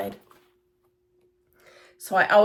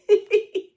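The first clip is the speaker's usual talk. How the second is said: laugh; 13 semitones higher, 5 dB louder than usual. Real, not acted. Laughs about millions of cats.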